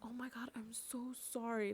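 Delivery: soft, anxious apology in a put-on voice; mock scared apology. Scared partygoers apologizing after bumping her, softly anxious. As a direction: apologize like you fear the answer. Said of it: "they were scared".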